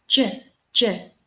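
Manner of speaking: J said as a short, separate "juh"; isolated J sound. Shown as a demonstration. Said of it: "But here it's J".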